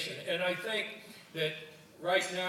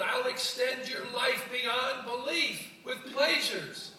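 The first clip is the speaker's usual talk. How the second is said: high, louder frog, insistent and put out; indignant frog; 10 semitones higher, 6 dB louder than usual. Acted. The ignored frog protests from the old man's pocket, high and insistent. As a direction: frog demanding to be heard. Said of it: "And the frog said".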